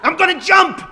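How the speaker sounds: high, full-force yell; loud, high-pitched threat; defiant shout. Acted. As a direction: yell it out from the rooftop. Boy threatens to jump from roof.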